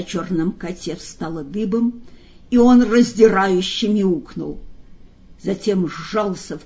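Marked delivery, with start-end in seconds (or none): none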